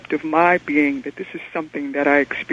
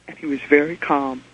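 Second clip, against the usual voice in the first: usual speaking voice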